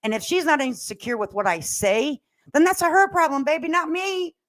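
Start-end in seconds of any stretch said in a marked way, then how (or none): none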